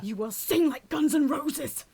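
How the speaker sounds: commanding call-out. Retold or imitated. She calls out the holdouts at the back, slow, loud and commanding. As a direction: demand it like a drill sergeant. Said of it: "calling them out".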